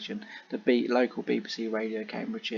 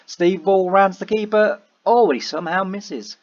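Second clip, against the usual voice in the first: excited; 8 semitones higher, 7 dB louder than usual. Real, not acted. Live chance in the game.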